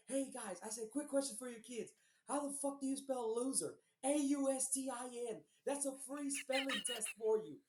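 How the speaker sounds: high, mocking yell at rival fans; high taunting stadium yell. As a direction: jeer at the whole section. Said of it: "I'm yelling".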